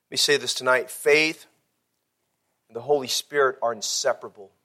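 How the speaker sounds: low, softened sermon voice with weighty pauses; hushed, solemn preaching. Real, not acted. Preacher teaching the church about faith and the Holy Spirit, hushed. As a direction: bring it right down and let each point settle.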